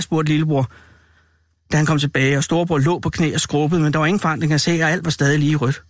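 ordinary delivery, nothing marked

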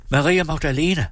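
high-pitched urgent call. A narrator's reading. Mother calling her daughter for help, urgently. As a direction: call the child over, stopping short of a full shout. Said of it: "råbte hun".

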